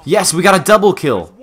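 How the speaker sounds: high, loud triumphant shout; excited cheer. Real, not acted. Player cheering a double kill, excited. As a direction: celebrate loudly.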